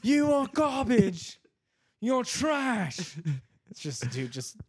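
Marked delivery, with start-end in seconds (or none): 0.0-3.2 high, slow, loud mock insult; mock yelling Gordon. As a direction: bellow it like a furious chef, hammed-up. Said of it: "Do you like to kill kids".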